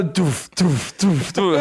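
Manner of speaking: club beat noise. Retold or imitated. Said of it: "imitating club music".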